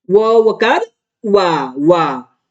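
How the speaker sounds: व वकार वा said slowly, each part kept apart; slow spelling out. Shown as a demonstration. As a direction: build up वा slowly from its letter. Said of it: "वा लिखना है". (व वकार वा धीरे-धीरे अलग-अलग बोलकर)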